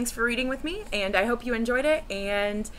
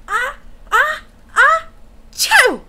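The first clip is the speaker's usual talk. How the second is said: big, loud, high mock sneeze building up; enormous sneeze; 11 dB louder, much slower than usual. The bear sneezes the mouse out, huge hammed-up sneeze. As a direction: wind up and explode into a giant sneeze. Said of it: "gave an enormous sneeze".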